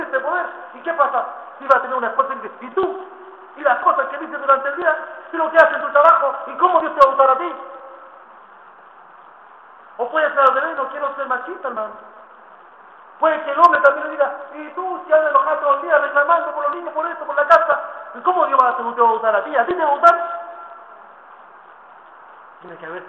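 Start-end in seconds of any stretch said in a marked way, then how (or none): 2.6-7.5 raised, loud accusing questions, speeding up; reproachful challenge. Acted. Husband confronting his wife at home, loud reproachful questions. 14.5-20.4 higher, loud, fast accusing questions; reproachful retort. Acted. Husband answering his complaining wife, loud accusing retort.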